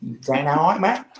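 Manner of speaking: broad Queensland Aussie greeting, lightly imitated; Australian accent. Imitating how Queenslanders greet each other, light Australian accent. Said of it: "the way they greet each other is".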